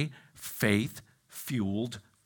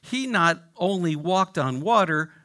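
normal talking voice

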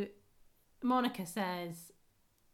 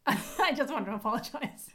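amused, higher voice with laughter breaking in; laughing apology; 4 semitones higher, 4 dB louder than usual. Real, not acted. Podcast host apologising to American listeners, laughing. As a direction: apologise through giggles.

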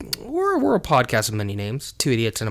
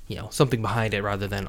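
ordinary talk, nothing marked

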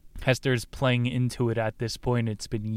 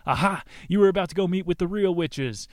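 gotcha; 6 semitones higher than usual. Retold or imitated. Officials catching Hester.

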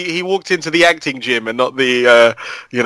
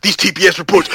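fast, higher, gruff wrestler hype; wrestling promo; 7 semitones higher, faster than usual. Acted. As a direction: cut a promo like a pro wrestler. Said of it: "cutting a wrestling promo".

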